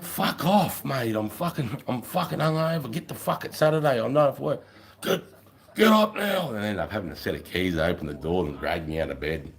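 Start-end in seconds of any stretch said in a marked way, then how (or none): 0.0-6.5 high, put-on grumpy voice; hungover retort. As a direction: snap back like you're woken hungover.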